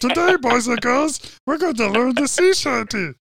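high puppet teaching voice; Grover voice. Pretend. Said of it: "I started to sound like Grover".

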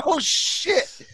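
shocked. Retold or imitated. Voicing the friends seeing the blast, mock shock. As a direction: act caught off guard.

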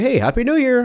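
high-pitched, mock-cheery hello; exaggerated perky greeting; chirpy greeting. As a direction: imitate an over-friendly well-wisher, way up high. Mimics a chirpy New Year greeter.

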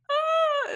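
high-pitched little "ah" of dismay; mock distraught cry. Marianne seeing Colonel Brandon walk in, mock distraught. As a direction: imitate an overwrought squeal. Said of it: "distraught that she has to leave".

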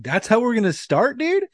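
high-pitched incredulous retort; indignant disbelief. Acted. Recruit answering an examiner who called him a liar, high indignant disbelief. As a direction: protest it high, as if insulted.

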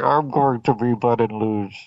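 hint of a muffled villain voice; lightly voiced masked villain; Bane voice. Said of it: "Did he sound like Bane to you?".

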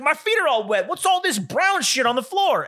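retold yell pitched far above normal talk; yelling man. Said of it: "What are you fucking yelling about".

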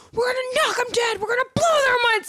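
high, fast, over-the-top pep talk; mock hyped shout. Quoting Jesse's violent pep talk, high and hyped. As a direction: pump it up high and quick like a maniac. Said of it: "the things she's saying are like".

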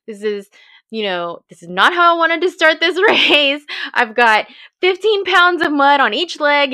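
frustrated rant. Retold or imitated. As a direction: vent about playing catch-up. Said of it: "getting more frustrated".

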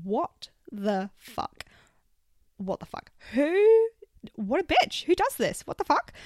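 incredulous, building to high loud outrage; can't believe she just did that; spluttering indignant what the fuck; outraged disbelief. Retold or imitated. Outraged inner reaction to stolen park.

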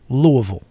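Louisville as LOO-uh-vul, falling; local pronunciation. Shown as a demonstration. Host repeating the correction for rookies, Louisville as LOO-uh-vul. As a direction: say Louisville slurred down to LOO-uh-v'l. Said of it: "It's Louisville".

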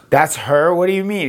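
retold startled pushback; incredulous question. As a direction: question it as if caught off guard. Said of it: "And I go".

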